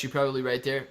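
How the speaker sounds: normal talking voice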